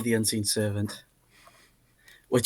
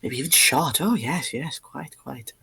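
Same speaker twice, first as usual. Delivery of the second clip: lightly voiced snooty onlookers, softer; posh crowd murmur; 4 dB quieter than usual. Bidders in the crowd murmur along, softly snooty. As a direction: murmur agreement like stuffy aristocrats. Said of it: "some of the people around go".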